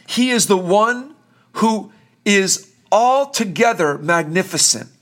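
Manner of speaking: normal talking voice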